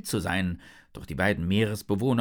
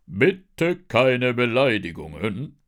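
loud, slow, booming shark policeman; deep official voice; 3 semitones higher, 7 dB louder, slower than usual. A narrator's reading. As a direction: intervene slowly and loudly with deep authority. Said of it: "eine tiefe Stimme". (tiefe, laute Polizistenstimme)

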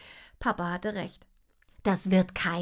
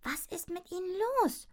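sister whispering; 10 semitones higher, 4 dB quieter than usual. A narrator's reading. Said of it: "flüsterte meine Schwester".

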